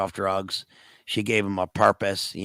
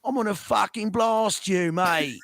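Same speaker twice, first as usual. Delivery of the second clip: mock English accent; 9 semitones higher than usual. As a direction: growl threats in an overdone Cockney accent. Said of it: "just do your English accent".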